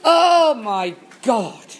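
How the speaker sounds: loud, high drawn-out "oh", then dropping; exasperated outburst. Real, not acted. Co-host reacting to being reminded of her Facebook rant, exasperated outburst. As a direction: burst out in exasperation, then settle.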